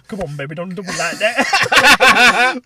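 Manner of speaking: loud, high, drawn-out mock plea; hammed-up emotional wail, breaking into laughter; retold welling up. Dad retelling his plea to baby daughter, mock tearful. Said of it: "like, well up".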